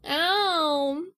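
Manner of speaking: ordinary talk, nothing marked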